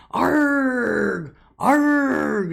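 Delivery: loud high pirate "Arg!"; pirate growl. Retold or imitated. Granddaughter playing pirate at the table, loud growl. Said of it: "she just started going".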